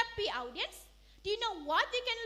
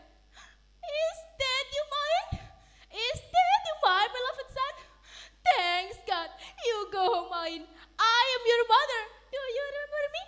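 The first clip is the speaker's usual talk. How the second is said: higher, louder, tearful joy; crying out; 6 semitones higher, 8 dB louder than usual. Acted. Mother reunited with her son, tearful and joyful. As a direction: weep with relief. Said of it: "She cried".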